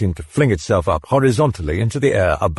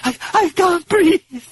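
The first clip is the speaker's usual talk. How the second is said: very high screaming pleas; terrified pleading. Acted. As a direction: beg for it to stop. Possessed girl begs in pain.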